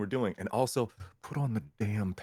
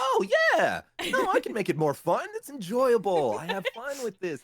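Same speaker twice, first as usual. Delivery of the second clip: much higher, louder, hammed-up fun read; more fun; 11 semitones higher, 4 dB louder than usual. Shown as a demonstration. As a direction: overdo the fun, bouncing high. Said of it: "a little bit more fun".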